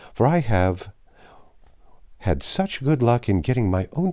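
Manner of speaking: put-on a chatty, insistent old hostess pressing her invitation; Mrs Jennings voice. Mrs Jennings urging the sisters.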